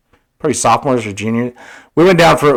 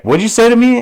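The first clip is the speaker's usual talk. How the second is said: mock affronted officer, pitch jumping up; pissed-off officer; 9 semitones higher than usual. Police officer challenging a kid over his wording, mock pissed. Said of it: "you can tell he's getting pissed".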